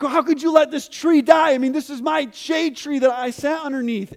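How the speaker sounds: high, loud whiny Jonah complaining; whiny complaint. Acted. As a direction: whine at God about losing the shade tree. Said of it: "starts complaining to the Lord".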